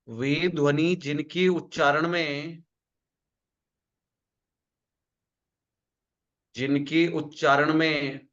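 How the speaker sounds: slow, word-by-word dictation; slow dictation to a class; dictation pace. Shown as a demonstration. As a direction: spell it out slowly so students can write. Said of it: "लिखो".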